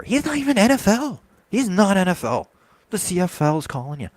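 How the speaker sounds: loud, high fan rant, almost shouting; furious rant. Real, not acted. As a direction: blow up in disbelief at the quarterback. Ranting about the LSU quarterback.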